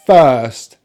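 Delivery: "first" with a long "er" and silent R; British "first", long middle vowel; non-rhotic pronunciation. Shown as a demonstration. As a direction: say "first" loud and careful, no R. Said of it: "not gonna pronounce the letter R".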